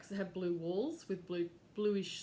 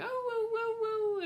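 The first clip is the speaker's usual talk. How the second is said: dog howling; 4 dB louder than usual. Retold or imitated. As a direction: howl it out like a scared dog. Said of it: "I could hear her going".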